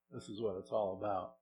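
ordinary delivery

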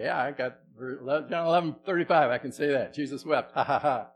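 cocky schoolkid showing off; mock-proud child rattling off a verse; smug kid reciting. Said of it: "And we'd say".

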